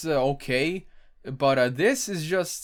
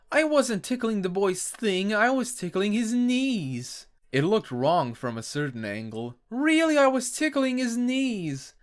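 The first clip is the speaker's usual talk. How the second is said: high cartoon pony voice; 6 semitones higher than usual. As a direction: insist it like a filly caught out. Pony insists she's innocent.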